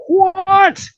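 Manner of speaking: very high loud outraged What?; shouted what. Retold or imitated. Reading the lawyer's all-caps text, high loud outraged What?. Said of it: "in huge capital letters".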